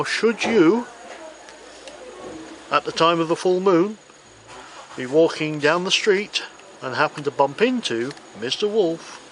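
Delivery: ordinary talk, nothing marked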